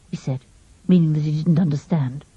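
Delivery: usual speaking voice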